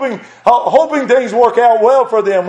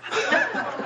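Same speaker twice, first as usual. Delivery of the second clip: high laugh; 12 dB quieter than usual. Real, not acted. Laughing at the granddaughter's retort.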